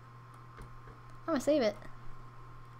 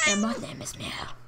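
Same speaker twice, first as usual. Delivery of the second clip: a breathy whisper for a tiny cat; whispered cat intro; whispered squeaky character; 6 dB quieter than usual. Pretend. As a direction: hush it like a little creature.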